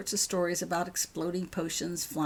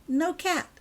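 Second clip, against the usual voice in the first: much higher, gleeful and bright-eyed; happy; 9 semitones higher, 4 dB louder than usual. Acted. Girl showing mum the hidden cat, gleeful. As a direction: show off the trick with glee. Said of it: "holding up the bag happily".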